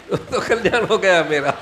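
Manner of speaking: words breaking into laughter; laughing. Real, not acted. Teacher amused by the group's Hindi numbers, laughing. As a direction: say it through a real laugh, then keep laughing.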